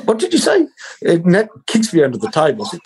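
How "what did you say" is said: very high, squeaky What did you say; shrill-pitched mock outrage from a churchy man; appalled accountant. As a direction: voice leaps way up in horror. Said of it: "He goes".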